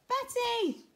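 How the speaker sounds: high, loud dramatic call; storybook hero's cry. A narrator's reading. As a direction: cry out to a loved one in alarm. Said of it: "cried Harry".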